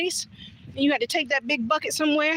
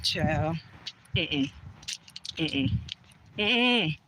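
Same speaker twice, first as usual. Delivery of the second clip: low, soft drawn-out mm-mm; knowing disapproval; 7 semitones lower, 5 dB quieter, much slower than usual. Real, not acted. Telling viewers the walk went badly, low knowing mm-mm.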